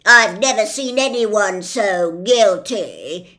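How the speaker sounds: loud, slowish officious policeman; pompous policeman. Acted. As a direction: lay down the law with bluster.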